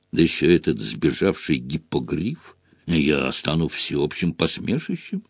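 low, quiet lament; dejected, complaining softly; sorrowful sigh. A narrator's reading. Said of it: "горестно вздохнул Фадж".

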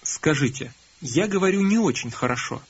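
ordinary talk, nothing marked

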